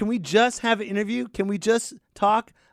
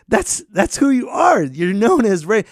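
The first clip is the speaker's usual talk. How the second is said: ordinary delivery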